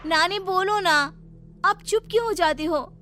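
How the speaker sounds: put-on high girl's voice, nagging and pleading; pleading young Seema. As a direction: beg nani to answer you. Seema pleads with silent nani.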